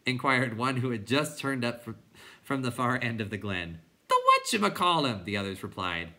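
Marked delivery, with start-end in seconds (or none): 4.1-5.2 raised silly clown voice; clown creature voice. A narrator's reading. Clown informing the others, raised silly voice. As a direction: announce it in a pitched-up voice.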